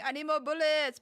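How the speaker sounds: mock urgent demand; meme quote voice. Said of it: "the meme of the guy that is saying".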